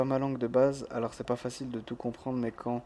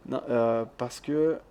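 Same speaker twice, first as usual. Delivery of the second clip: ordinary talk, nothing marked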